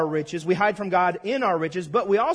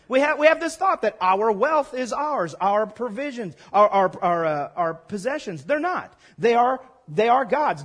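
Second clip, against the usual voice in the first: usual speaking voice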